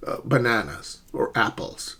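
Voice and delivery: usual speaking voice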